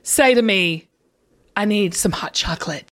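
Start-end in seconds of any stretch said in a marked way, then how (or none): 1.5-2.8 low, quiet, mock-solemn line; very serious. Woman directing her co-host to the camera, very serious. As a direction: say it gravely, pretending it's serious. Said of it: "Be very serious".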